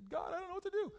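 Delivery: high, mock-helpless whine; whiny panic. Pastor imitating a panicking believer, high mock whine. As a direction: squeak it like a helpless kid.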